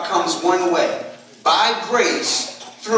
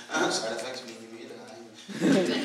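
mumbled disclaimer; 6 semitones lower, 10 dB quieter than usual. Retold or imitated. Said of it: "sort of real quickly".

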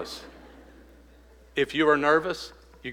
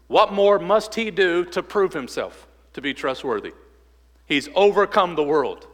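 louder, higher rallying call to trust; fervent preaching; 4 semitones higher, 5 dB louder than usual. Acted. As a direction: build the appeal up to a peak. Preaching at full force.

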